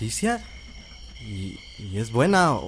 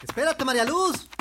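much higher, urgent call; calling after her; 14 semitones higher than usual. Acted. Suitor calling after the fleeing girl, urgent and high. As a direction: chase her with your voice.

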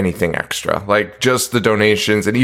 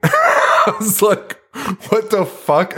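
mock shock; 10 semitones higher, slower than usual. Retold shocked reaction at office.

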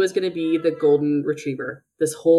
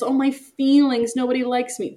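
high, self-pitying mock whine; whiny poor-me act; 7 semitones higher than usual. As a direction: imitate a sulker with hurt feelings. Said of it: "he's so whiny".

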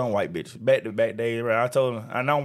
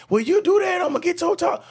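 much higher, nagging mock of the coworker; high mocking whiny voice. Mocking the annoying coworker in a high whiny voice. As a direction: whine it high like the nagging lady. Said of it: "coworker threatens to tell".

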